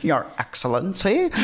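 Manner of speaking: questioning American fan voice with a rise; mock American accent. Host mimicking Americans again, mock American accent. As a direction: ask it like an awed American. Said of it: "they're like".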